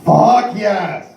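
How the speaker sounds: loud, high delighted yell; excited shout. Retold or imitated. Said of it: "she just heard me talk and went".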